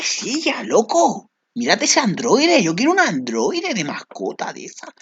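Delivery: high, near-tearful gushing; excited squeal. Real, not acted. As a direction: squeal with longing over the chair. Gushing over the chair and android. (chillido emocionado, casi llorando)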